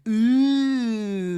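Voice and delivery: long high "ooh"; drawn-out high comic coo; silly walk noise. Retold or imitated. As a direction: imitate a silly sneaking "ooh". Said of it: "a weird little walk, like".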